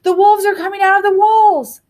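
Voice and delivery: high, loud warning shout; father shouting, pitch way up; shouted alarm. A narrator's reading. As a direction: raise the alarm for the whole house. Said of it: "shouted Lucy's father".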